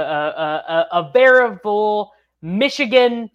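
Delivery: ordinary talk, nothing marked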